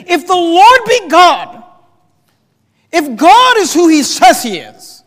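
high, loud, slow exasperated cry; exasperated prophet calling to a crowd; frustrated. Acted. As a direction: throw it out at full voice, drawn out. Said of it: "where I really sense the frustration".

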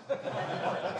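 quiet unvoiced chuckle; soft breathy laugh. Real, not acted. Pastor laughing at receiving the book, quiet. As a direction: laugh to yourself.

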